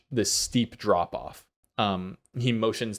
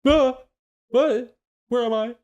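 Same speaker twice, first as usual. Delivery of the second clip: high, loud, bewildered animal voice; confused camel voice; 8 dB louder than usual. Acted. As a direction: squeak it out like a lost camel.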